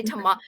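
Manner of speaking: "toma-" with a British "ah", cut off; British pronunciation. Retold or imitated. American student questioning her own slip, British "ah" in "toma-". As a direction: start "toma-" as to-MAH and break off. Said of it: "saying things differently now".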